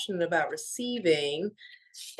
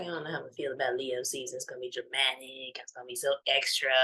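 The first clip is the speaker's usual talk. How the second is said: low, quiet, fast aside; dubious inner thought; 9 semitones lower, 6 dB quieter, much faster than usual. Retold or imitated. Recalling her earlier dread of Leo season, dubious mutter. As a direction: mutter a past worry quickly under your breath.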